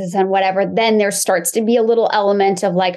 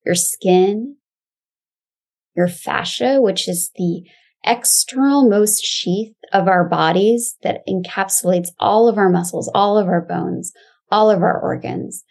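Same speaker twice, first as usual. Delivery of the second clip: calm grounding exercise. Acted. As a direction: guide them gently into the body.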